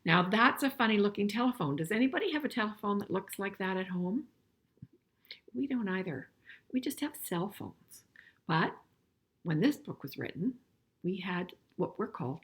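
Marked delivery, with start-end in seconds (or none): none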